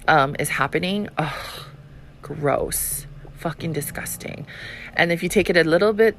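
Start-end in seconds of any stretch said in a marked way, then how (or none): 1.2-4.5 quiet, repulsed groan of disgust; disgusted ugh. Real, not acted. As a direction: softly recoil in disgust. Disgust at abuse story.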